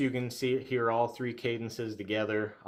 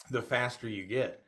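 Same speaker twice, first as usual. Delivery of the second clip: ordinary talk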